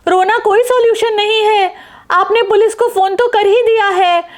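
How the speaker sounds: calm her friend down; consoling neighbour. Acted.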